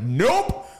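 high, loud, abrupt exclamation; panicked refusal. Retold or imitated. Kid bolting from his dad, high urgent yelp. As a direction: reenact an instant decision to flee.

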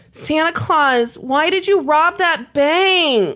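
put-on high, slow child's voice; shaky little boy. A narrator's reading. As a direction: plead like a small upset boy. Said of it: "a little boy cried out in a shaky voice".

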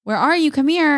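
calling nicely. Retold or imitated. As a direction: call out sweetly for the child. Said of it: "asking him nicely".

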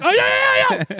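very high, loud, sheepish yeahs; high nervous voice. Retold or imitated. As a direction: squeak out a guilty yes. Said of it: "I'm like".

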